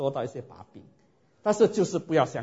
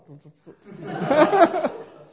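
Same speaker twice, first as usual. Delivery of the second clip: high, loud falling laughter; long laugh; 14 semitones higher, 9 dB louder than usual. Real, not acted. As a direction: laugh hard at the memory. Laughs at brother's teasing.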